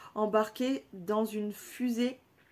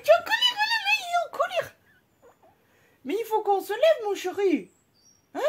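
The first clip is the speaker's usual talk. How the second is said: squeaky, much higher and louder pet talk; 12 semitones higher, 6 dB louder than usual. Real, not acted. Owner cooing to her angry pet, high baby voice.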